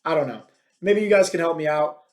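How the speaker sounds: ordinary delivery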